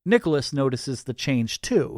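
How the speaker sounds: ordinary delivery, nothing marked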